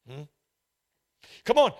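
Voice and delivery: ordinary talk, nothing marked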